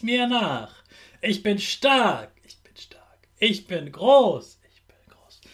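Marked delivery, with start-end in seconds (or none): 2.5-3.2 breathy whispered repeat; whispered echo. Shown as a demonstration. Repeat-after-me affirmations. 4.7-5.4 soft whispered repeat; whispered echo. Shown as a demonstration. Repeat-after-me affirmations.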